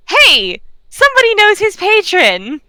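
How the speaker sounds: bright, high, eager exclamation; light up at the news; bright happy shopkeeper; delighted. Acted. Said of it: "smiles super bright and says".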